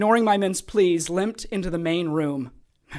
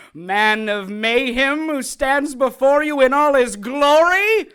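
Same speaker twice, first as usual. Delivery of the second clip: higher, louder grand proclamation; grandiose villain; 6 semitones higher, 8 dB louder than usual. Acted. Kay boasting to his captive, loud grandiose villain. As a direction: present yourself like a showman.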